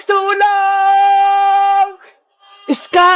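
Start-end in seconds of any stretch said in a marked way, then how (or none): 0.1-1.9 long, held-out scream for help; cry for help. Acted. Princess trapped by Wednesday, screaming for help. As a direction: call out desperately, stretching the word. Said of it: "Sigaw yun ni Princess!".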